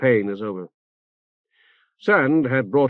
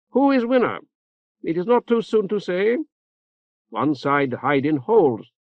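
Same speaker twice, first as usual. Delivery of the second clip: higher, proud oratory in broken foreign English; lightly voiced foreign noblewoman; 6 semitones higher than usual. A narrator's reading. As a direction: orate grandly like a foreign dignitary. Sayuf addresses the council.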